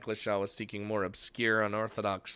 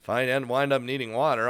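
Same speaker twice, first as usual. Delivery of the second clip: usual speaking voice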